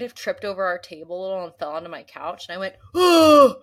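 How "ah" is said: sudden loud gasp and yelp; startled yelp. Retold or imitated. Tripping onto the couch, a loud startled yelp. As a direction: yelp as if falling.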